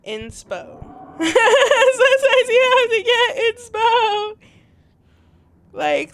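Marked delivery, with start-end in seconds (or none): 1.2-4.4 high, loud, slow words through laughter; laughing hard. Real, not acted. Player explaining her clip break, laughing loudly. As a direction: squeal it out while cracking up.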